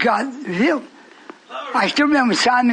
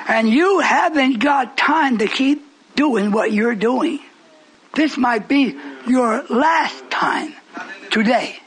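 slow; slower than usual. Real, not acted. Slow warning to congregation.

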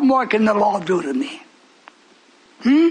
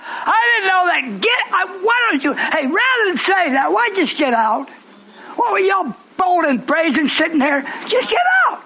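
rebuke; 8 semitones higher than usual. Real, not acted. Rebuking congregation, rising.

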